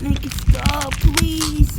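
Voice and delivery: loud, desperate screamed begging; screamed plea. Acted. Kid begs loudly for the change to stop.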